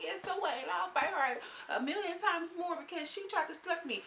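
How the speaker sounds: high, defiant retort; voicing Barbie's defiant retort. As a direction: toss it back like a brat.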